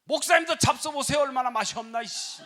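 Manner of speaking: high, loud talking back; defiant yell. Retold or imitated. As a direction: shout back in bratty defiance. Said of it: "말 대꾸 하다가 이런다". (신랑이 목사에게 높고 크게 대드는 말대꾸)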